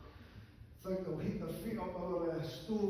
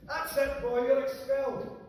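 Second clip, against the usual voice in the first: higher, louder teacher snaps; furious teacher; 8 semitones higher, 9 dB louder than usual. Acted. Teacher expels the boy, raising his voice. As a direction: throw the boy out, shouting. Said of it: "for Mick to his teacher".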